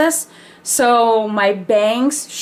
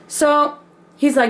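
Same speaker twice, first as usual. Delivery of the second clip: ordinary talk, nothing marked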